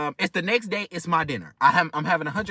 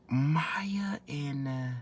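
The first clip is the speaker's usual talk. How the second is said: whispering the name to himself; whispered, trying to remember; 3 semitones lower, 10 dB quieter, much slower than usual. Real, not acted. Storyteller whispering to himself while searching for a name.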